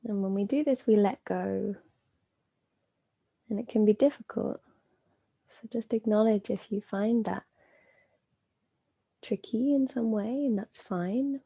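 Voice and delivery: calm, unhurried yoga nidra guidance with long pauses; measured meditation voice, phrases spaced out; guided meditation. Acted. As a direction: guide them inward, leaving space between phrases.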